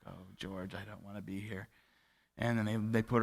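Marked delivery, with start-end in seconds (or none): 0.1-1.7 hushed, near-breaking voice; a frail, quiet plea of despair; soft sad plea. Retold or imitated. Grandma to grandpa George at the care home, softly sad.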